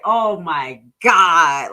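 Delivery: slow, loud drawn-out oh my God; mock shock. Viewer sees the post, slow mock shocked gasp. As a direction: stretch it out in mock disbelief. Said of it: "And you like".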